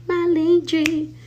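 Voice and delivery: high, slow sing-song "link tree"; high sing-song repeat. Shown as a demonstration. High sing-song "link tree" teasing the live viewers. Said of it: "That's what I was trying to say".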